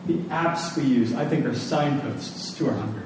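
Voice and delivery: normal talking voice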